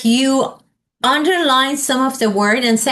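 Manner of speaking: usual speaking voice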